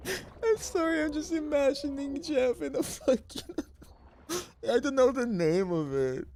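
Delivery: very high pitch through laughter; laughing. Real, not acted. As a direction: squeak it out laughing.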